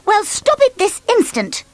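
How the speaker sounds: high, firm command; bossy order. Acted. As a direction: snap it like a strict nanny. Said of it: "ordered Miss Bossy".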